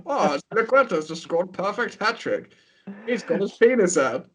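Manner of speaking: lightly voiced match commentary; a hint of a commentator calling a hat trick; mock commentator. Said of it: "that'd be great commentary".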